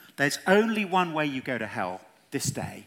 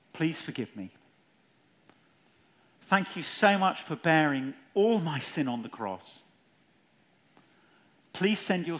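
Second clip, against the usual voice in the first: phrase by phrase. Shown as a demonstration. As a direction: leave room for others to echo each phrase. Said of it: "I'll say it phrase by phrase".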